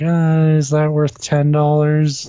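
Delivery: high, drawn-out reluctant wondering; hesitant. Retold or imitated. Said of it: "like, hesitant".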